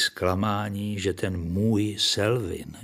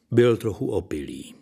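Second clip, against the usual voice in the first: ordinary delivery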